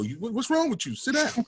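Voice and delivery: daughters' sassy reprimand, imitated; daughters' scolding. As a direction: lightly mimic bossy daughters telling you off. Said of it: "when they go".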